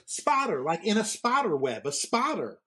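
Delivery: put-on high, exasperated Southern accent insisting on "spotter"; mock-explaining "spotter" in a broad Southern drawl; a Southern vet repeating "spotter" impatiently, Southern accent; thick Southern accent. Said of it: "he had the thickest Southern accent".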